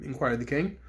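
ordinary delivery, nothing marked

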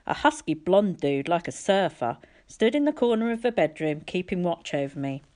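usual speaking voice

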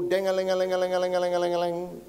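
rapid ding-a-ling on one held note; imitated cartoon jingle. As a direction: rattle it off like a cartoon till. Imitating cartoon cash-register eyes.

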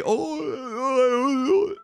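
high, slow mock-whiny teasing "oh, oh, oh"; high mocking wail of the Twitch chat. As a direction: imitate gloating viewers jeering. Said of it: "A la mínima que digo algo mal".

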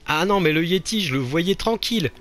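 usual speaking voice

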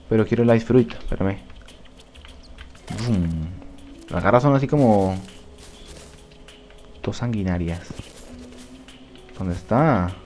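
normal talking voice

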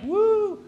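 high, loud whoop; crowd "woo". Retold or imitated. Comic imitating a lively late-night crowd, high whoop. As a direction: let out a cheering whoop. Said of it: "the crowd's like".

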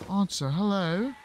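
ordinary talk

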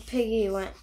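ordinary delivery

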